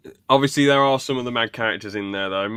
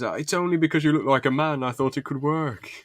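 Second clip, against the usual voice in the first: higher put-on voice for a character; imitated Scottish bloke; 5 semitones higher than usual. Scottish bloke explaining himself to Jen, higher imitated voice. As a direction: plead it in a raised imitation voice.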